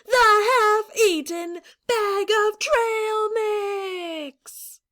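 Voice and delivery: slow, high, booming reveal; hammed-up epic announcement; dramatic announcer. A narrator's reading.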